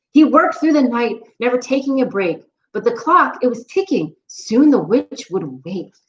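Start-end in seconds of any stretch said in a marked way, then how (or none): none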